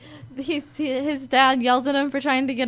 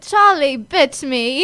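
put-on upset British boy; 6 dB louder, slower than usual. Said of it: "He goes".

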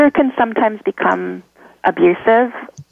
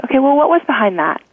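higher-pitched, quick probing question; acted inner questioner, quick and higher; self-questioning; 6 semitones higher, faster than usual. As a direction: ask yourself briskly, pitched up. Acting out a self-questioning exercise.

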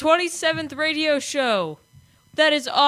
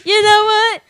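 higher, louder sassy retort; mock indignant; 7 semitones higher, 7 dB louder than usual. Real, not acted. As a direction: fire back with sass. Retort in banter.

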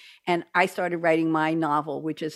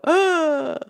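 shocked gasp; 10 semitones higher, 5 dB louder than usual. Retold or imitated. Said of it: "they'd go".

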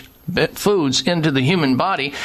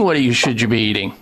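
normal talking voice

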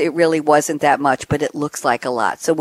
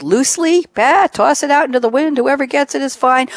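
higher, breezy bah toss-off; carefree dismissive; 8 semitones higher than usual. Pretend. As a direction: shrug it off, high and breezy. Mocking careless data sharing.

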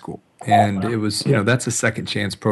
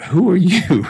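much higher, louder disbelief; mock amazement at the changed kid; astonished; 9 semitones higher, 7 dB louder than usual. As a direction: gasp it at a stranger. Kid returns transformed.

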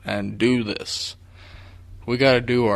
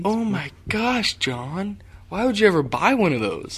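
exasperated disbelief; 8 semitones higher than usual. Real, not acted. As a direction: plead with a friend over a terrible choice. Exasperated at friend's choice of truck.

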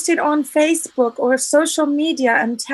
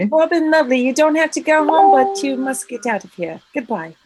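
put-on English accent. Host bids the audience farewell in a mock English accent. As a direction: send them off in a hammed-up English accent. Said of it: "you're supposed to do the English accent".

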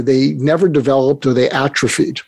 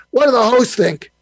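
Larry's Brooklyn voice; 9 semitones higher than usual. Retold or imitated. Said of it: "in his Brooklyn voice said".

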